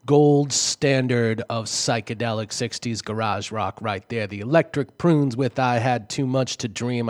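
radio DJ voice. Acted. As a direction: announce it like a DJ on air. Radio DJ back-announcing songs.

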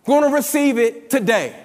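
high, loud, slow preaching climax; full-force preaching. Acted. Pastor exhorts the church to bring faith, at full force. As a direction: drive it home to the congregation.

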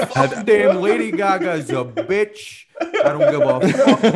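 high, slow, hammed-up ranting through laughter; mock outraged rant. Podcaster imitating actors trashing [name], laughing mock rant. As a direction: imitate a blunt actor going off, stretched out.

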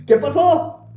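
high, loud worried shout; asking the frightened coworker what happened, alarmed; alarmed call. Retold or imitated. Calling to scared coworker.

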